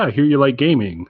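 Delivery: usual speaking voice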